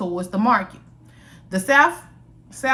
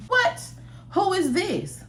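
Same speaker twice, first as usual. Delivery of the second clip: much higher, shocked what who is this; startled reply; 11 semitones higher, slower than usual. Acted. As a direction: jump up in pitch like a sudden fright. Sierra's startled text reply.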